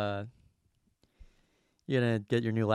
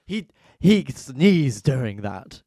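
loud, slow mock-scandalised; mock outrage; 2 semitones higher, 10 dB louder, much slower than usual. Mocking British news over tiny things, mock outrage. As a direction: gasp it like a tabloid scandal. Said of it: "they're like".

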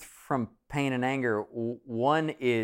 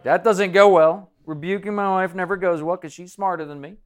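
hammed-up nervous husband, louder; mock worried husband; 6 semitones higher, 8 dB louder than usual. Husband imagining rebuking his smarter wife, mock dread. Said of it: "You're like".

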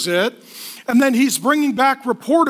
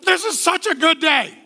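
put-on cheery voice, slightly raised; mock cheerfulness; 3 semitones higher than usual. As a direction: play absurdly happy while being arrested. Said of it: "And he's like".

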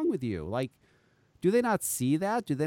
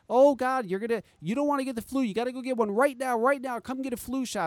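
higher-pitched mock urgency, quick and pushy; mock alarm; 6 semitones higher than usual. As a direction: imitate a pestering public warning. Mimics media pushing flu shots.

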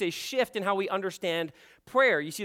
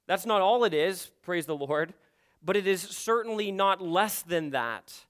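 ordinary talk

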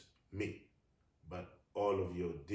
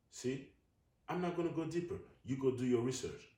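dismissive; 6 semitones higher, 4 dB louder than usual. Real, not acted. Dismissive higher aside.

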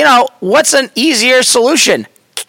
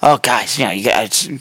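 hint of an urgent Giles rallying the gang; lower, hushed urgent announcement; grave warning; 8 semitones lower, faster than usual. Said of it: "more like".

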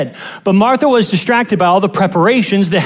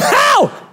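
much higher mock cry of pain; mock yelp. Driver with a flat tire, high mock yelp. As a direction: yelp it like a hurt drama queen. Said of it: "you're like".

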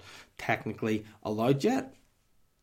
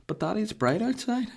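very high little-child imitation; mock toddler pleading, pitched way up; toddler reply; 11 semitones higher than usual. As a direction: argue back like a small kid. Voices toddler arguing.

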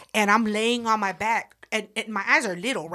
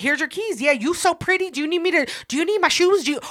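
higher, louder, eager to please out of fear; nervous flattery; 9 semitones higher, 5 dB louder, faster than usual. Retold or imitated. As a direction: suck up to her so she spares you. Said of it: "I was scared shitless".

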